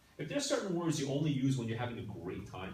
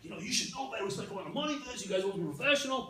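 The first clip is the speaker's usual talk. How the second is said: mock angry; 7 semitones higher, faster than usual. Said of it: "was angry. He was very angry".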